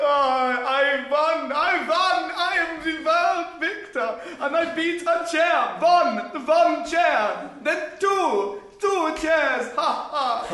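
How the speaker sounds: maniacal rant. Acted. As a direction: crow over the win like a mad conqueror. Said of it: "the traditional maniacal rant of victory".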